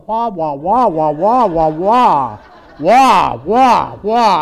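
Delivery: loud laughing wah-wah honks; loud mock trombone wah wah; loud wah-wah drone. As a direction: belt the cartoon teacher drone, laughing. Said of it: "Just like Charlie Brown's teacher".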